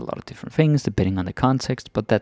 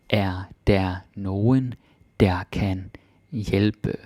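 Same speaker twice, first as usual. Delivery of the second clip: slow for learners; much slower than usual. Shown as a demonstration. Slow split repeat.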